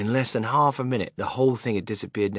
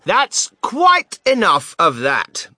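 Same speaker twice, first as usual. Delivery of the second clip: much higher, louder, slow and stern; firm shout; 8 semitones higher, 9 dB louder, slower than usual. Acted. The shopkeeper shouts the crowd down, firm. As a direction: put a stop to it loudly. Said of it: "the fat shopkeeper shouted".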